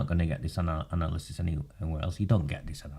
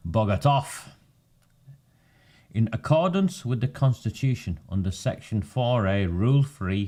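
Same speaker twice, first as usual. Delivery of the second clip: slow, louder formal read-out; formal letter reading; 2 semitones higher, 3 dB louder than usual. Acted. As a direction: read it like an official notice. Reads a formal council letter.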